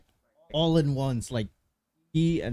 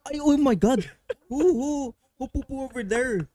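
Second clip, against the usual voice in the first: put-on high, loud Filipino accent; 10 semitones higher, 6 dB louder than usual. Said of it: "that strong Filipino accent".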